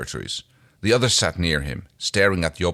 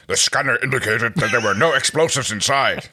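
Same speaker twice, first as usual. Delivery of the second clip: gruff, louder warrior voice; Dargo voice; 4 semitones higher, 5 dB louder than usual. Acted. Dargo reporting the scanner result, gruff warrior voice. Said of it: "That's actually a really good Dargo".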